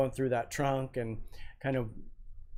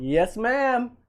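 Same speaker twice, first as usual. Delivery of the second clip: mock polite; 11 semitones higher, 9 dB louder than usual. Mock deference after retort.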